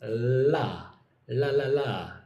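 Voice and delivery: La with the tongue tip on the top of the mouth; tongue tip up behind the teeth, La; L sound demo. Shown as a demonstration. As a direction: clear L, tongue on the roof of the mouth. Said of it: "on the top of your mouth".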